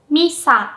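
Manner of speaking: Misa with MI high and SA dropping low; high-low pitch accent. Shown as a demonstration. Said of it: "Mi is pronounced with a high pitch".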